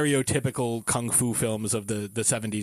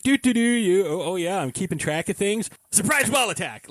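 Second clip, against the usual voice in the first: high, sing-song innocent act into a surprise attack; mock bookkeeper ambush; 7 semitones higher, 3 dB louder than usual. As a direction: play busy and harmless, then spring the attack. Acting out bookkeeper's sudden attack.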